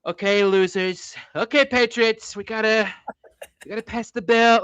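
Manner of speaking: high-pitched, slow-paced politician impression; Trump. Podcaster mimics a president rallying patriots, exaggerated intonation. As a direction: catch the intonation and pace, not the voice. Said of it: "like Trump".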